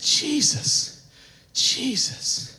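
softly whispered plea; hushed cry out. Acted. Preacher voicing the lepers' appeal to Jesus, hushed cry out. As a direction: call the name in a reverent whisper.

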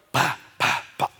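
short unvoiced "bah" pops; imitated bone clacks. Preacher showing bones coming together, imitated clacks. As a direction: puff out percussive impact noises.

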